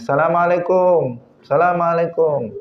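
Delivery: high called-out "Assalamualaikum"; calling at the door. Retold or imitated. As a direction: call it out through a closed door. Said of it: "dia ngetuk-ngetuk pintu".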